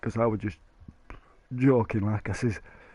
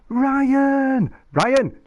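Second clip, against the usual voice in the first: sharp higher call across the room; 10 semitones higher than usual. Real, not acted. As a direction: call his name to get his attention. Calling out to friend.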